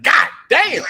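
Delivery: loud, high mock shout of "God damn!"; Eddie Murphy exclamation. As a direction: blurt it out, shocked and hammed up. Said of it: "He was like".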